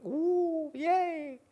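very high squeaky mock cheer; secret glee. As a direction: squeal with hidden delight.

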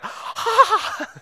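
very high-pitched squeal; imitated excited cry. Four friends seeing the man get up, mock excited squeal. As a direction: let out a high, thrilled mock cheer. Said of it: "the four guys are like".

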